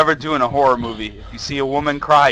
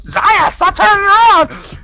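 high, loud, drawn-out mock nagging; imitating viewers yelling; 9 dB louder, slower than usual. Player mimicking viewers' complaints, hammed-up high yell. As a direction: squeal it like exasperated fans heckling. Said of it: "you guys are like".

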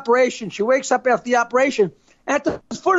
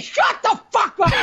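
higher, half-shouted put-down; mock snap; 9 semitones higher than usual. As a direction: bark it at the wife. Husband snaps at wife.

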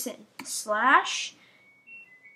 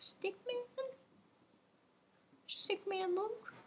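very soft, high questioning call; hushed calling; 8 semitones higher, 13 dB quieter than usual. Real, not acted. Player searches for Stickman Luke, hushed high call. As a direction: call the name almost in a whisper, rising.

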